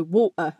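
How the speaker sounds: "water" with a stop in the throat for the T; Cockney "wa'er" for "water"; Cockney glottal stop. Shown as a demonstration. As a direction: block the breath mid-"water", then release. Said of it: "stops everything".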